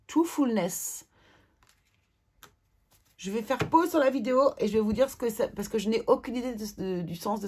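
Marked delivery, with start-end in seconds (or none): 0.1-1.1 slow, careful English word in a strong French accent. Real, not acted. Admitting her accent will be terrible, reading slowly in French-accented English. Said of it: "avec mon accent, ça va être terrible".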